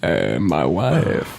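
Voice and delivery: slow, low put-on voice; Borat. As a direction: drawl it like Borat. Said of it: "my Borat impression".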